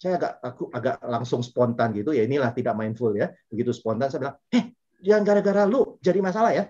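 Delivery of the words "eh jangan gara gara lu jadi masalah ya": raised, sharp mock scolding; harsh retort. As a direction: snap at the unmasked man, higher and louder. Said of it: "dengan nada yang agak keras".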